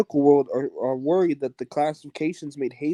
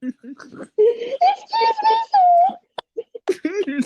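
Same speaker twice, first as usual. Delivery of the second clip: squeaky laugh; 6 dB louder than usual. Real, not acted. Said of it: "you sound like a little kid".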